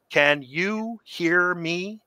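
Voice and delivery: drawn-out question, pitched high; slow, careful checking. Retold or imitated. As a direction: enunciate slowly as if he might not understand. Director up close to suited performer.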